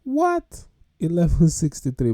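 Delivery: high, loud disbelieving what; stunned at the price; shocked. Real, not acted. Shocked at car payment.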